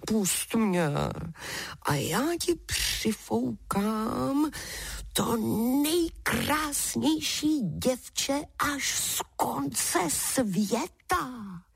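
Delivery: high, slow, drawn-out giant voice; tempting giant. A narrator's reading. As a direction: plead and tempt, stretching every word.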